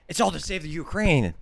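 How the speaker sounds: impersonating a ranting pundit; shouting it in fake fury; mock rage. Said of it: "go into this, like, rage almost".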